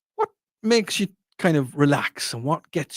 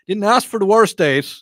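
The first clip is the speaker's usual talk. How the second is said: mock protest; 6 semitones higher, 7 dB louder than usual. Real, not acted. Protesting a listener's text.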